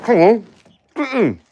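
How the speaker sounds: high loud call to the dog. Real, not acted. Owner calling Gertrude the dog inside, loud and high.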